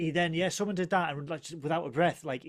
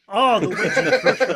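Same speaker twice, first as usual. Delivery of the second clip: louder, higher, laughing through it; laughing delight; 7 semitones higher, 12 dB louder than usual. Real, not acted. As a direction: burst out laughing as you repeat it. Delighted repeat of a joke.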